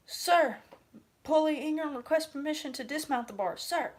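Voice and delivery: high, loud barked military answer; shouted recruit reply. Retold or imitated. Recruit sounding off to the recruiter, loud and high. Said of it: "you're gonna say".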